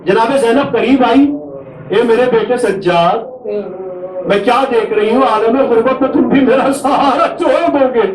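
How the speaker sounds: keen the tragedy aloud to draw the mourners to tears; masaib lament. Acted. Preacher to mourners at a majlis, high wailing lament.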